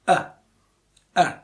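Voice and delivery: are said as a short a; weak form of are. Shown as a demonstration. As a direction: reduce are to a quick a.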